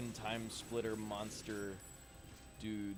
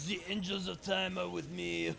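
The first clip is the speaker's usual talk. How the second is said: put-on French accent; 7 semitones higher, 3 dB louder than usual. Repeats the French accent bit.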